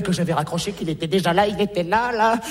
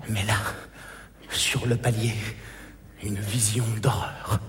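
dramatic hush; 12 semitones lower, 9 dB quieter than usual. Acted. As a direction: drop to a hushed gasp of horror. Dramatic horror reveal.

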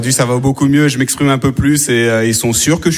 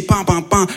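siren imitation; 4 semitones higher, slower than usual. Shown as a demonstration. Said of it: "Les policiers".

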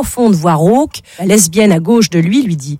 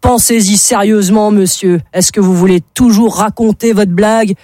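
usual speaking voice

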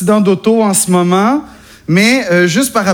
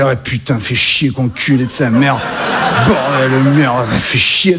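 low grumbling Parisian accent; 8 semitones lower than usual. Shown as a demonstration. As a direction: growl it like a cliché Parisian. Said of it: "imiter l'accent parisien".

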